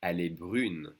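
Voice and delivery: brune with a French U and a held N; pronounced N. Shown as a demonstration. Teacher modelling the form for a girl, pronounced N. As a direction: sound the N at the end of brune. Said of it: "we do pronounce the N".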